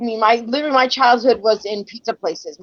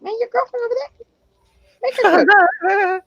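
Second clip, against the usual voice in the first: sassy retort; 12 semitones higher than usual. Retold or imitated. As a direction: tease her son, stretching the last words. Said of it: "I'm like".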